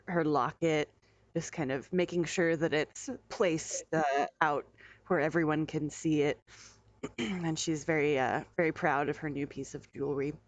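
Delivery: ordinary talk, nothing marked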